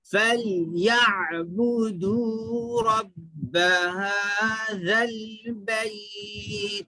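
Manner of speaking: slow drawn-out melodic chant; Quranic recitation. Shown as a demonstration. Teacher recites to students, slow tajweed chant. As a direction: recite it slowly with long held vowels.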